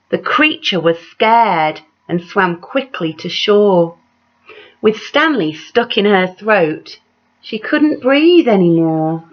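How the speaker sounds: lively read-aloud with big rises on the refrain; story narration. A narrator's reading. Reading a picture book aloud to children, animated storyteller voice. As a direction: tell it like a bedtime picture book.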